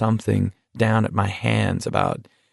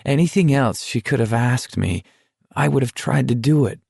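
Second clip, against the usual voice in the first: ordinary delivery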